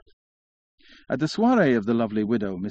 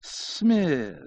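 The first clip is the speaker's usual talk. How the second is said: high, long-drawn single syllable; drawling syllables; 6 semitones higher than usual. A narrator's reading. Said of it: "drawling out his syllables".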